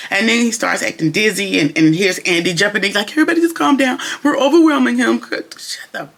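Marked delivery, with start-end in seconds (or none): 3.1-5.2 higher, fretful calming plea; Andy calming everyone, high fussy plea; mock pleading. Andy jumping in. 5.2-6.1 annoyed whispered cut-off; irritated whisper, cut short; whispered annoyance. Real, not acted. Annoyed aside.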